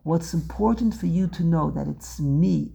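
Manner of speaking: usual speaking voice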